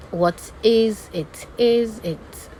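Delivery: "is" lifted above "What"; rise on second word. Shown as a demonstration. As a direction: slowly push "is" up, then fall on "it". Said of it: "you rise on the second word".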